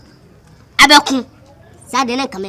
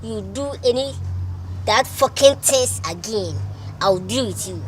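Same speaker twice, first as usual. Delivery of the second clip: quiet, measured threat; 8 dB quieter than usual. Acted. Threat closing an angry outburst.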